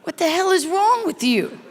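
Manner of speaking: exasperated outburst. Acted. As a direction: snap at your husband in comic disbelief. Outraged reply to husband's spider theory.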